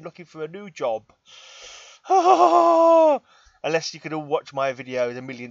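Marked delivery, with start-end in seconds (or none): 2.0-3.2 high, loud theatrical sigh; hammed-up weary sigh; loud dramatic sigh. Real, not acted. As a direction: heave an exaggerated sigh. Big sigh over unemployment.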